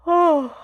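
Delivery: big, high yawning "oh". Real, not acted. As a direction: let a loud yawn escape on the word. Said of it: "without yawning my face off".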